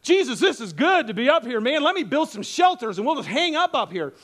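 loud, eager gushing; thrilled and bubbling over; Peter, all psyched. Retold or imitated. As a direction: pitch the plan with excited energy. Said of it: "So Peter's all psyched".

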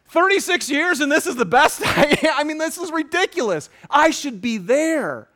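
high, heated disbelief, slowing at the end; exasperated rant. Retold or imitated. Preacher replays his complaint to his counselor, exasperated. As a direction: rant high, then stretch out the last words.

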